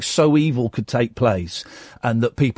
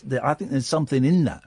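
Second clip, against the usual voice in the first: ordinary delivery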